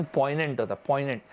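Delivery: पोइनेंट said with the g silent, poy-nent. Shown as a demonstration. Teacher models पोइनेंट for exam students, g silent. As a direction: say पोइनेंट, no g sound at all. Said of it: "यहाँ पे जी silent होता है".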